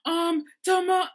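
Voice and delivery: high, loud panicked phone call; imitated hysterical farewell; frantic roommate. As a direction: shout a desperate goodbye down the phone.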